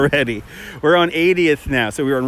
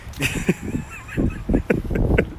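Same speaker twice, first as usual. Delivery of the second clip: squeaky burst of laughter; high laugh; 6 dB quieter than usual. Real, not acted. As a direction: breaks into a high giggle. Laughing at own movie joke.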